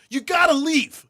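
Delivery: high, loud panicked pleading; hammed-up begging teen; pleading urgently. Teen begging mom to drive off, loud panic. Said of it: "I'm like".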